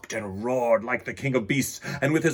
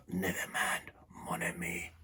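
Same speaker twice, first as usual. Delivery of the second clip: soft, breathy whisper; 5 semitones lower, 11 dB quieter than usual. A narrator's reading. Hoarse trainer comforting the lion in a whisper. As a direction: whisper it tenderly, voice lost. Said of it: "he whispered".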